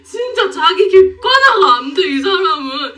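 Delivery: laughing till crying; words squeezed through laughter; laughing hard. Real, not acted. (눈물 나게 웃으며)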